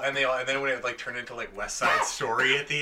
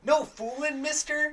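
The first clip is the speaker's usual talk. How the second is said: very high, cartoonish kid voice; mock eager sidekick. Mocking the wrestler's comedy bit, high eager sidekick voice. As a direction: pitch it way up, mocking an awkward bit.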